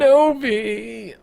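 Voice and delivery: high and slow, drawn out. Acted. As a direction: stretch the name out high. Drawn out title name.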